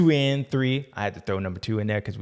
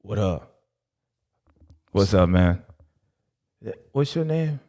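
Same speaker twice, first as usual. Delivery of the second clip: a hint of a lower, worn-out guy, lazy complaining; lightly voiced tired character; 3 semitones lower than usual. The caddy complains about Jay, a lower lazy grumble.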